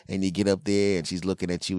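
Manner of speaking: usual speaking voice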